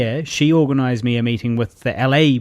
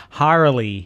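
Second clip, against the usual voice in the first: Harley with an American R; American accent. Shown as a demonstration. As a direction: pronounce Harley the American way, R clearly sounded. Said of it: "I just had to quickly start going".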